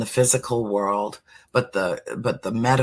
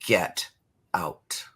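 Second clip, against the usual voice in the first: low, firm "Get out", with "out" dropped much lower and softer; firm banishing command; 5 semitones lower, 3 dB quieter than usual. Shown as a demonstration. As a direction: order the spirit out, calmly final. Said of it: "that's all I have to say".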